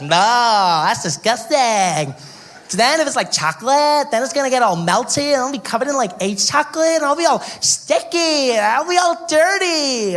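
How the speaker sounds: high, whiny disgust; mock disgusted girl. American girl whining in disgust at the comedian. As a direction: whine like a spoiled girl. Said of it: "She's like".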